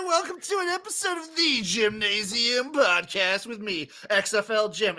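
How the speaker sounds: high, over-projected radio host intro; hammed-up morning DJ welcome; douchebag radio voice. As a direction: do a cheesy radio-host opener. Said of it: "I just did a bullshit douchebag radio voice".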